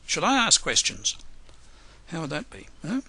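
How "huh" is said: usual speaking voice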